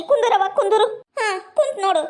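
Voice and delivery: half-crying pleading, voice catching; tearful. Acted. To her friend before sad news, tearful pleading. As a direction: sob through the words as she begs her to sit.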